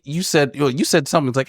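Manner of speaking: usual speaking voice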